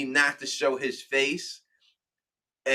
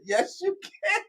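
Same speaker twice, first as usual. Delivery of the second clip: high put-on Quinn voice, laughing; 4 dB quieter, slower than usual. Answering the fan in his Quinn voice. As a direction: slip into a high Quinn impression. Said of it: "I go to my Quinn voice".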